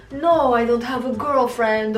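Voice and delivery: mock boyfriend in a would-be Serbian accent; accidental Serbian accent. Woman mimicking her cheating boyfriend's denial, accidental Serbian accent. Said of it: "why is that a Serbian accent?".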